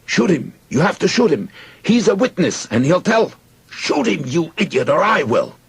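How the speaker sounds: very high, loud frantic urging; shouted. A narrator's reading. Soldier urging his friend to kill the witness, frantic shout. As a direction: yell it, pushing hard for action. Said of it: "The other soldier shouted in Hebrew".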